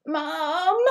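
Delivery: high, panicked call for mom; a boy calling out in terror; terrified cry. A narrator's reading. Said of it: "His voice has gone all high-pitched with terror".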